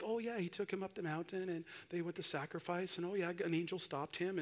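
soft, dismissive mock summary; imitating a casual, unbothered reader; offhand recap. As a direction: rattle it off quietly as old news. Mimics casual, unthinking Bible readers.

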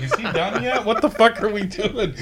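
high, laughing exasperated actors; mock the bored cast, laughing; mock fed-up cast. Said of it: "the cast is like".

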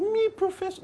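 high, airy brush-off of the title; mock modest denial. Professor feigning modesty at her introduction, high coy voice. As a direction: play it coy and falsely humble.

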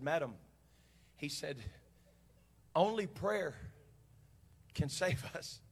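soft, halting solemn reply; gravely serious. Retold or imitated. Captain warning a woman in a storm, grave. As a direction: deliver grave news with heavy pauses. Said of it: "so seriously".

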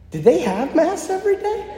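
high-pitched bewildered question; confused. Retold or imitated. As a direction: ask it in mock bewilderment, pitch shooting up.

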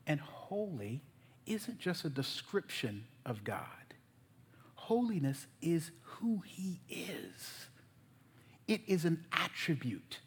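low, soft, deliberate teaching; slow, careful explanation. Real, not acted. Preacher carefully defines holiness for the congregation, slow and hushed. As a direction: take it slowly and softly, letting each point land. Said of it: "I'm gonna go slow here".

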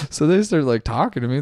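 ordinary talk

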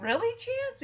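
high, questioning cat voice; Sassy. Acted. Cat answering Chance at the reunion, high questioning. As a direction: lilt it up, touched. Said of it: "she's like".

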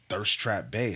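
usual speaking voice

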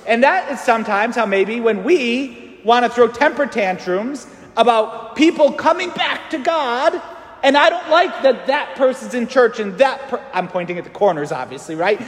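ordinary delivery

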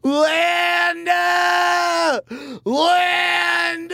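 long loud wail of a name; anguished wail. Acted. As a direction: cry out her name in despair. Wailing for ex-wife.

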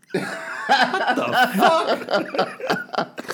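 high, stretched-out laughing what; laughing disbelief. Real, not acted. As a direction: crack up in disbelief.

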